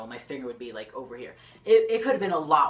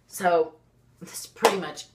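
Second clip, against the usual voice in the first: ordinary talk, nothing marked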